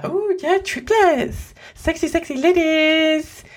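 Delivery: very high, drawn-out mock ogling; hammed-up squeal over sexy ladies; mock excited leering. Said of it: "I was like".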